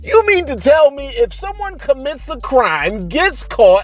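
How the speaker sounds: high-pitched indignant rant rising to a shout; a teacher finding out he's underpaid; outraged disbelief. Real, not acted. Teacher comparing his pay to snitches, high outraged shout.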